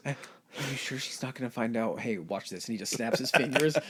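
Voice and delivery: ordinary delivery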